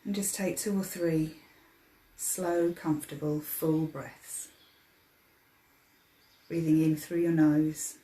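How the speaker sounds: soft, unhurried meditation voice with long pauses; guided meditation. Acted. Meditation leader easing listeners into their breathing, guided meditation. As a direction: guide them gently into relaxation, softly.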